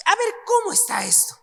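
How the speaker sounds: a sudden "wait, what?" at a contradiction; teacher voicing a confused student, jumping up high; puzzled surprise. Puzzling over a paradox.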